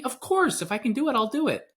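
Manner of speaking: very high, willing reply; eager agreement. Acted. Answering a close friend's favour, acted eager agreement. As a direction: jump to say yes, pitched right up.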